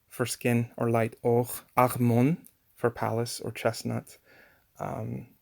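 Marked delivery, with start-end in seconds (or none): 1.8-2.4 "armon" with a throaty French-style R; guttural R. Shown as a demonstration. Teacher quoting modern Hebrew speakers, guttural R. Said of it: "So they'll say".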